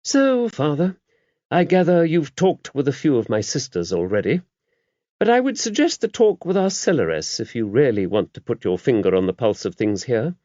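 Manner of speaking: mother superior confiding in a priest; the abbess. A narrator's reading. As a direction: voice the abbess kindly and knowingly. Abbess voiced in the story.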